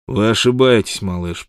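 ordinary delivery

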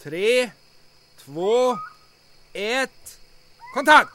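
counting down before setting off the charge, ending in a shout; countdown to a blast. Acted. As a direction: count down, then yell the trigger word. Countdown to detonation.

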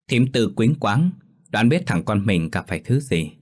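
ordinary delivery, nothing marked

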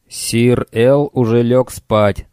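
slow hoarse speaker voice; wheezing loudspeaker. A narrator's reading. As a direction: rasp it out through a speaker. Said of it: "прохрипел репродуктор над дверью".

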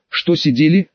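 ordinary delivery, nothing marked

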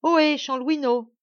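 hailing a man on the road below; calling out. Acted. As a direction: call up to him through cupped hands. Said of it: "il l'appela".

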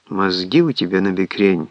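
ordinary delivery

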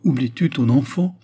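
pleading cry; cried out. A narrator's reading. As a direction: plead loudly, reminding him of his child. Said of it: "m'écriai-je".

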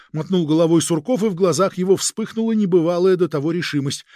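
ordinary delivery, nothing marked

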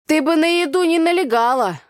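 loud, high folksy warning; Tanya warning. Acted. Tanya warning her friend about eating. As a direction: warn her off the food. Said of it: "предупредила меня Таня".

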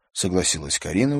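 ordinary delivery, nothing marked

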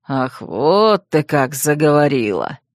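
low, slow predatory purr; menacing purr. A narrator's reading. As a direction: purr it like a cat with cornered prey. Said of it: "Внезапно промурлыкал Бер голосом".

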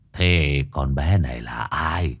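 put-on slow old man's questioning; drawn-out elderly voice; old shaman. A narrator's reading. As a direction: ask slowly, as an old shaman.

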